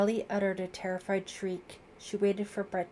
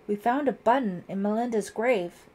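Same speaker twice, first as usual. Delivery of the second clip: louder, urgent announcement; crying out; 3 semitones higher, 8 dB louder than usual. A narrator's reading. Girl tells lieutenant about the grave, crying out. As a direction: call the news out urgently. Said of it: "Ellie cried".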